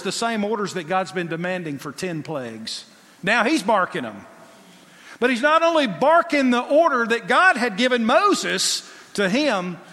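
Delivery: usual speaking voice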